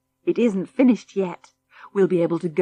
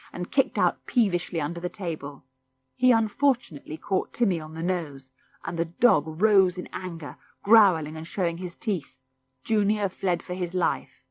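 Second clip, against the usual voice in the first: usual speaking voice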